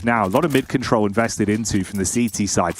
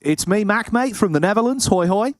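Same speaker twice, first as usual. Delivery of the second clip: mock Australian accent; 9 semitones higher than usual. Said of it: "mocking co-caster as Dutch Aussie".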